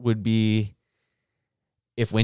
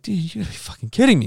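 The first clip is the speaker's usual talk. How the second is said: exasperated; 9 semitones higher, faster than usual. Retold or imitated. Speaker exasperated at dumb guy.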